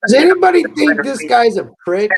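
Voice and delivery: loud call out to the chat; shouting. Real, not acted. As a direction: yell it to the whole chat.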